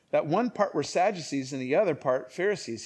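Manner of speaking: usual speaking voice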